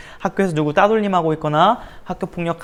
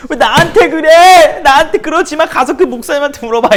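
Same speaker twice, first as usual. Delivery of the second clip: whiny protest; 12 semitones higher, 11 dB louder than usual. Retold or imitated. Bullied teen to classmates, high loud pleading whine. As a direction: whine it like a cornered kid begging off. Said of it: "처음에 그랬어요".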